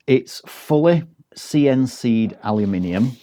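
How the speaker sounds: usual speaking voice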